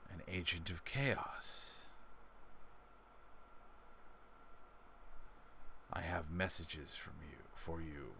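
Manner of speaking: low, soft, slow otherworldly voice; ominous entity. Acted. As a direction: speak like an ancient being, hushed and low.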